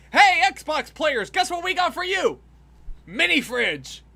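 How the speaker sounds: high, loud, stretched-out presenter shout; mock hype announcement. Mocking E3 presenters to Xbox players, loud hype announcement. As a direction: parody an over-excited games showcase host.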